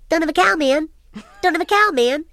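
high, sped-up squeaky toy-doll Bart; Bart on helium. Retold or imitated. Mother recalls son's Bart doll, high helium Bart voice. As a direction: squeak it out like a talking doll with a short string. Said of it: "sorta sounded like Bart on helium".

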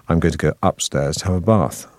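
proper RP English accent; received pronunciation. Shown as a demonstration. Actor showing what drama school taught, received pronunciation accent. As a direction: say bath with a long 'ah', in RP. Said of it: "when you do receive pronunciation".

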